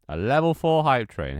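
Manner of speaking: loud, high hype announcement; excited. Real, not acted. Streamer celebrating a level four hype train, excited.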